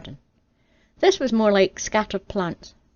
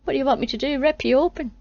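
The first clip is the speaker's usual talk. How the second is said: higher incredulous retort; exasperated; 6 semitones higher, faster than usual. Acted. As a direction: throw it back, exasperated. Adam exasperated.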